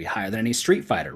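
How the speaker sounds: normal talking voice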